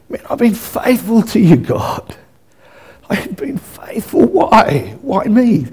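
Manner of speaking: hushed, breaking into near-whisper; acted bewildered prayer, softly pleading with God; anguished plea. Voices stricken believer questioning God.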